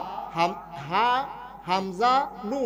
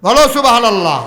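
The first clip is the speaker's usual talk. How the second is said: much louder cue to crowd; loud call; 3 semitones higher, 13 dB louder than usual. Real, not acted. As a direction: rouse the crowd to answer. Calls audience to respond.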